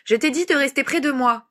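high, indignant scolding; wrathful. A narrator's reading. Grandmother scolds grandson who ran off, angrily. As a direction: reprimand the runaway child crossly. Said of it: "une voix courroucée".

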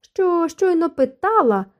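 drawn-out wail. A narrator's reading. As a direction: stretch the words out to get his attention. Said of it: "заволала Елоїза". (заволала, повільно й високо)

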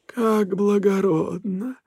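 slow, high, sneering spirit; mocking otherworldly voice. A narrator's reading. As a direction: smirk through a cold bow. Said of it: "ухмыльнулся Смергл". (насмешливый потусторонний голос)

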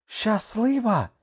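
high, loud, drawn-out indignant cry; stretched, incredulous outcry; exclaiming. A narrator's reading. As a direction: cry it out high and slow, falling off at the end. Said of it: "вигукнула Кокуа".

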